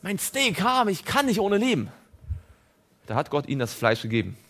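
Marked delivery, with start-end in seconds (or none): 0.0-1.9 high whiny mock plea, not shouted; mocked crying believer begging for steak; mock whining. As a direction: plead like a child for steak. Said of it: "schreit und sagt".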